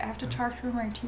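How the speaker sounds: hushed golf announcer voice. Shown as a demonstration. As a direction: murmur it like a golf commentator on TV. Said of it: "have the lower part of your teeth talk through them".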